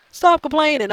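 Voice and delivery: high, loud mocking whine; mocking defenders. Vlogger mocks fans telling people to stop complaining, high whiny voice. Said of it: "tell people to stop complaining".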